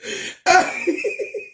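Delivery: very high wheezing laugh; high cackle. Real, not acted. Streamer laughing hard at the voting page, high cackle.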